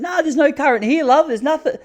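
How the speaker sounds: high, put-on old auntie voice, brushing it off; Auntie Joy. Brother-in-law quoting Auntie Joy at the beach, high old-lady voice. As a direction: mimic the auntie sweetly denying the current.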